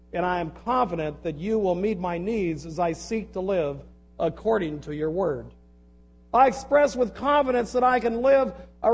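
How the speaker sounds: earnest, steady prayer reading aloud; declaring a prayer with conviction. Acted. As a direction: pray it out for the congregation, firm. Reads a written prayer aloud.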